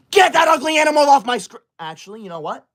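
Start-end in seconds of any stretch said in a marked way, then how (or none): 0.1-1.6 high, loud bossy shout; shouted command, pitched up; bratty shout. Acted. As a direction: yell at the gift to get off. Yelling at a gift on screen.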